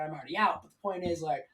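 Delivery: usual speaking voice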